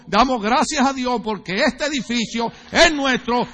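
from firm to a ringing shout; rising preacher's proclamation. Real, not acted. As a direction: preach it up to full force.

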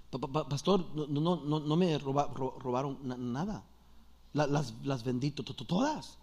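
low, quiet voice catching on syllables; stuttering Juan. Acted. Juan tells the pastor nothing was stolen, low soft stutter. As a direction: stammer softly and timidly, well below your own pitch. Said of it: "Disculpen, es tar-, tar-, tar-, tartamudo".

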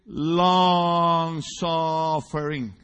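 long suffering stretched very slowly in English; long suffering, slow and drawn. Shown as a demonstration. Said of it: "En inglés".

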